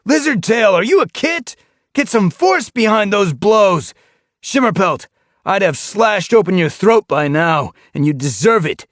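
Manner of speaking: harsh trainer shouting at his cats; berating shout. A narrator's reading. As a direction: tear into the lazy warriors. Leader berating training warriors.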